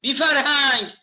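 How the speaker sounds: mock outrage at a family member glued to the TV; mock scolding.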